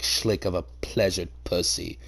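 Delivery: ordinary talk, nothing marked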